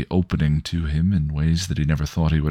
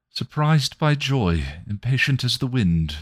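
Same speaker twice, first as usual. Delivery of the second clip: poem recital; 8 semitones higher than usual. Acted.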